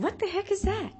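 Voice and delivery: recoiling at a strange photo; shocked disbelief. Retold or imitated. Speaker sees guinea pig on the plate, shocked.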